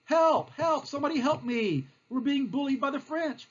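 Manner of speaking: high, plaintive cry for mother; hammed-up helpless calling out, pitched high; mock yelling for help. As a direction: wail for rescue like a bullied child. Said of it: "He's basically yelling".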